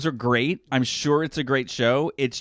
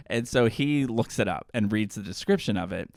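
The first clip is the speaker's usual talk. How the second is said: ordinary talk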